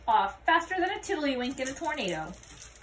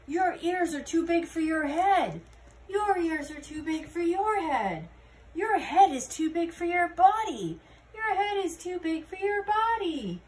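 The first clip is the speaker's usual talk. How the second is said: higher, louder mock teasing; 5 semitones higher, 4 dB louder than usual. Characters trading teasing lines, voiced higher. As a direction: tease him in a higher voice.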